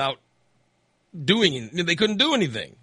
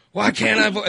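higher, louder mock demand; retold mad voter; 7 semitones higher, 4 dB louder than usual. Voter barking at election judges, mock angry. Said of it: "they get really mad".

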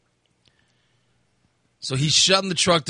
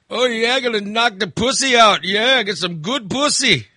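high, bragging mock Indian accent; weird Indian accent; 7 semitones higher, 3 dB louder than usual. Indian passenger bragging to driver, high imitated Indian accent. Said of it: "It was the weirdest accent ever".

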